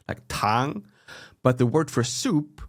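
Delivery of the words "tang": TANG gliding up in pitch; rising tone. Shown as a demonstration. Demonstrating the word for sugar, TANG rising. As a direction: say tang with an upward second tone. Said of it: "it goes up".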